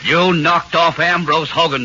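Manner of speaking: loud, high accusing shout; angry accusation. Acted. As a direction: slam the charge at him.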